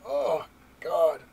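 loud, high "Oh, God" of disbelief; exasperated groan. Retold or imitated. Fan reacting to Spider-Man's death, loud exasperated cry.

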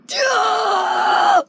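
long, high scream of excitement; real fanboy scream; hyped scream. Real, not acted. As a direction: scream out your hype. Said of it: "that was just me screaming out of hypeness".

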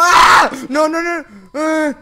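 loud high yell, then panicked nos; frightened scream. Real, not acted. Player startled by an animatronic, real frightened scream. Jumpscare in horror game.

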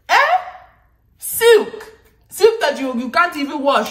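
squealed high, loud silk; mock-outraged cry of silk; incredulous exclaim. Real, not acted. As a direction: scoff at the idea, shooting way up. Mocking the silk advice.

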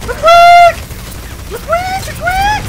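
very high, short rodent squeal; hamster squeak. Pretend.

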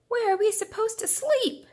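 very high, odd frog voice. Acted. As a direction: squeak it like a worried little frog. Frogs ask the rooster.

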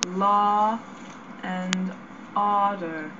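"Law and order" with a long open aw; aw vowel practice. Shown as a demonstration. Student practising the aw vowel with her teacher. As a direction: drill the aw vowel deliberately. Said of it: "aw sound".

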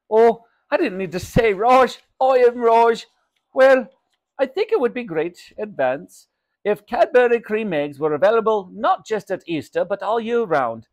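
animated shopkeeper, high and loud; Raj. Acted. Shopkeeper gives mother his opinions, animated.

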